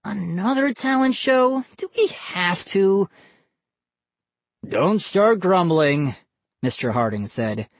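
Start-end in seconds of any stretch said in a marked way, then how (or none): 0.0-3.1 put-on high, groaning kid complaint. A narrator's reading. Boy hears of another family talent show, groaning. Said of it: "Vinny groaned". 4.8-6.2 slow, higher parental warning; scolding. A narrator's reading. Dad cuts off his son's complaint, scolding.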